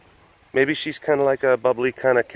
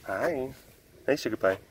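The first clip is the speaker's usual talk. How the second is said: tender greeting; 10 dB quieter than usual. Real, not acted. Streamer greeting a sugar pie, softly and tenderly.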